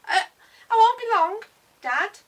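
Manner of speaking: high, very loud hasty call; shouting back. A narrator's reading. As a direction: yell it back to Dad while getting away. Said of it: "Shout and jump on the bike".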